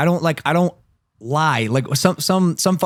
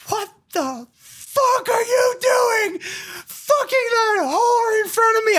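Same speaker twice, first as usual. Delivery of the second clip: high, slow mock-furious shriek; angry girl imitation; much slower than usual. Jilted girl screams at him in a bathroom, high mock shriek. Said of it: "She goes".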